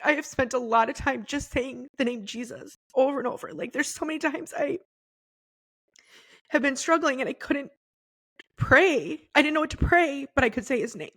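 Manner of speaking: high, tight tearful voice with long pauses; emotional, holding back tears. Real, not acted. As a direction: push the words out haltingly through real tears. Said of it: "probably the reason I'm emotional is".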